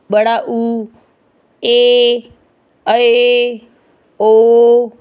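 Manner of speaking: ऊ, ए, ऐ, ओ each held out level; long vowels. Shown as a demonstration. Teacher listing long vowels, ऊ, ए, ऐ, ओ drawn out. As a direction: stretch ऊ, ए, ऐ, ओ like sustained notes. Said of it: "दीर्घ स्वर".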